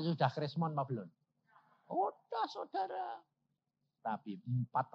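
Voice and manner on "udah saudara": much higher answer; high emphatic. Real, not acted. Preacher confirming to congregation that crisis had begun.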